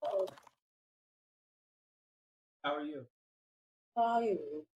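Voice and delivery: ordinary delivery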